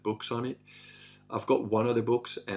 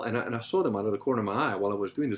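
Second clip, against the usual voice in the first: ordinary talk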